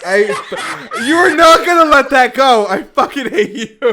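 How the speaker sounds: high, very loud protest through laughter; real laughing outrage. Real, not acted. Player teased about a four-door Mustang, laughing outrage. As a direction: yell it in exasperated, laughing disbelief.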